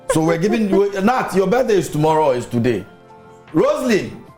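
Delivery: ordinary talk, nothing marked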